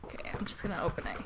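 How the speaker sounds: whispered, fast and tense; nervous whisper. Real, not acted. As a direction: hold your breath and whisper it. Nervous unboxing, hushed.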